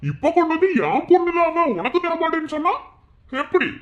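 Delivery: very high, loud screaming yell; all-out enraged shout; furious yell. Acted. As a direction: scream it at her. Peak of confrontation.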